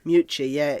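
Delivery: usual speaking voice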